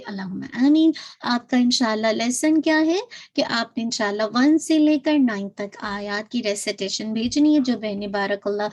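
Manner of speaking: ordinary talk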